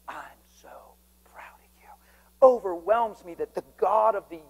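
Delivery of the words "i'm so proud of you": whispered, barely voiced; hushed whisper. Preacher whispering the Lord's words to him. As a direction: breathe it tenderly as the Lord. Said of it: "And he said".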